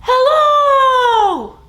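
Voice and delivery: big, high, loud hello. Shown as a demonstration. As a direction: call it out as loud as you can. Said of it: "Let's say hello as loud as we can".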